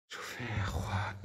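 slow hushed whisper; nervous slow whisper. Real, not acted. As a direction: breathe it out, barely audible. Hushed in a dark house.

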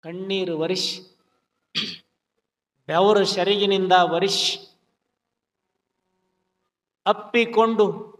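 ordinary talk, nothing marked

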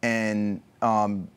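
ordinary talk

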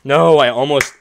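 sudden loud cry at a near miss; frustrated shout. Real, not acted. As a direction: burst out in protest.

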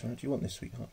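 murmured fondly to a pet; soft coaxing. Real, not acted. Coaxing pet tarantula with food.

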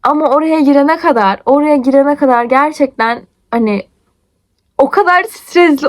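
normal talking voice

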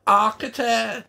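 loud, high, coarse cackle; cackling Cockney hag. A narrator's reading. Frau puppet mocks Gwen's protest, cackling Cockney. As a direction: jeer like an old puppet crone. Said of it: "cackled Frau Vogelberger".

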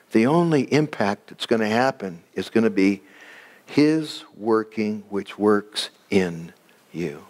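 usual speaking voice

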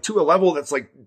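ordinary delivery

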